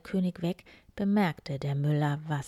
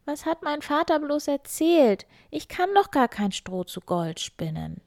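put-on higher, despairing girl's voice; miller's daughter, higher and fretful; desperate young girl; 9 semitones higher, 6 dB louder than usual. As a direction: plead to herself in despair.

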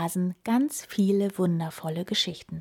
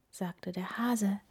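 plain reading voice

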